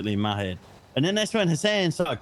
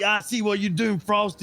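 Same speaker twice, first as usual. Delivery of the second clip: raised, mock-accusing voice for the angry Hassan; imitating Hassan; 8 semitones higher than usual. Retelling how Hassan called him out, imitating Hassan. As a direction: play Hassan catching you out, pitched up. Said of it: "He's like".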